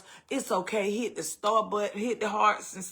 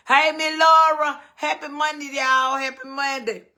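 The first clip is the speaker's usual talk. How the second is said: high, loud, happy hello; excited greeting; 7 semitones higher, 8 dB louder than usual. Real, not acted. Host greets a viewer joining her live, excited call. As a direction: call out to her like spotting a friend.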